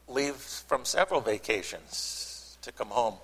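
usual speaking voice

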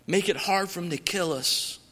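usual speaking voice

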